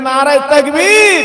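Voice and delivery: loud, drawn-out rallying shout; shouted takbir. Shown as a demonstration. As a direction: yell the slogan slowly to rouse the crowd. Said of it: "চিল্লে বলেন দেখি". (চিৎকার করে টেনে তাকবীর)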